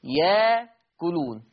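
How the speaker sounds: "ياكلون" very slow, long held aa; slow teaching demo of "ياكلون"; hamza becomes alif. Shown as a demonstration. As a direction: draw out "ياكلون" with no glottal catch. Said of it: "تصير إيه؟".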